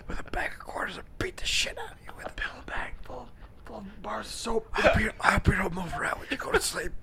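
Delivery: menacing whisper. Pretend. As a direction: whisper a mock threat. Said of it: "It's hard to whisper".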